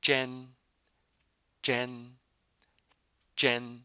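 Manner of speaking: jen with the tongue curled back, no Y glide; Chinese jen. Shown as a demonstration. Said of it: "when your tongue is curled, there's no Y sound".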